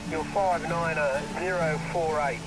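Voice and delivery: ordinary talk, nothing marked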